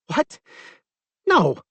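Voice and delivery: high, startled protest; alarmed denial. A narrator's reading. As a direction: deny it in sudden alarm. Amy denies in alarm.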